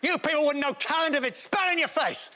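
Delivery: high, indignant complaint; bitter sore loser. Acted. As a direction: sulk like a snubbed nominee. Losing nominee complains bitterly.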